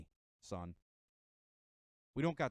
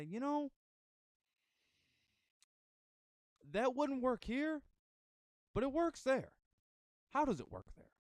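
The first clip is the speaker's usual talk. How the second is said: pitched well higher and slow, musing aloud; imagined thoughtful voice; 11 semitones higher than usual. Pretend. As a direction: voice a curious outsider weighing it up. Imagined open-minded person reasoning.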